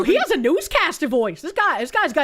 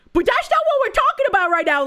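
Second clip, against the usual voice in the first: exasperated; 10 semitones higher, 4 dB louder, faster than usual. Real, not acted. Exasperated at her pivot.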